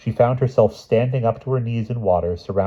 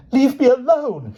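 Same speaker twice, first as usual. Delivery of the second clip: very high, loud, slow scream; the queen screaming at her sons; 5 dB louder than usual. Acted. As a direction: shriek at the children. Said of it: "she screamed".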